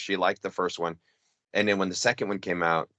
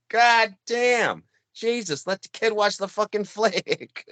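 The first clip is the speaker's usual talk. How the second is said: exasperated crowd heckle; 10 semitones higher, slower than usual. Retold or imitated. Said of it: "The people were like".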